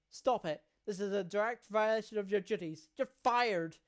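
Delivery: loud angry doctor yelling at the caregiver; furious shout. Acted. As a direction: bark orders in a rage, building to the firing. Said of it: "the doctor shouts at him".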